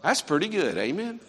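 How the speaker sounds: usual speaking voice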